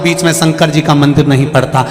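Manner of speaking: ordinary talk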